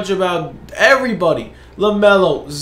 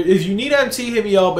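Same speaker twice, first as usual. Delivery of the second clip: normal talking voice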